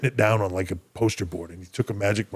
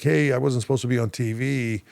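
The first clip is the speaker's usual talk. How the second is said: higher-pitched, mildly indignant retold complaint; retold protest; 4 semitones higher than usual. Wrestler phoning the office about being on TV, retold protest. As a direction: re-enact querying the office, pitched up.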